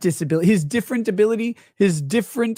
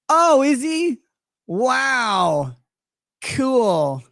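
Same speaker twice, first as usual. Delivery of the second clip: sarcastic; 5 semitones higher, much slower than usual. Acted. Sarcastic reaction to Dinklage casting.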